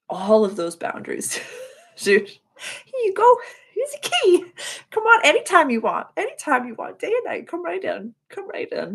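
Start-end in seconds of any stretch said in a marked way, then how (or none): 2.0-6.1 mock-invite them in with a sky-high voice; high mock host voice. To a friend, joking about being welcoming: high put-on voice. 6.1-8.9 high put-on voice, a bit softer; softer mock host voice. To a friend, still joking: softer high put-on invite.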